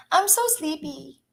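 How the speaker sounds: normal talking voice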